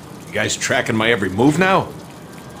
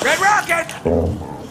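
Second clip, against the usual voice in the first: Red Rocket stretched very slow and much higher; high drawn-out call; much slower than usual. Acted. Deadpool calling out to the dog Red Rocket, slow and high. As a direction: sing the name out long to call the dog.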